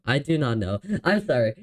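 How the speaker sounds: usual speaking voice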